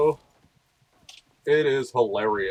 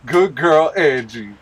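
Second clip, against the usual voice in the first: echoing the owner over the show, louder; louder echo of show line; 4 semitones higher, 8 dB louder, slower than usual. Retold or imitated. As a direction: repeat it louder.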